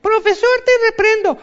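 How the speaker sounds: put-on squeaky kid scolding the teacher; Kiko. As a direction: Kiko in his uniform scolding Professor Girafales. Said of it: "es como si el Kiko".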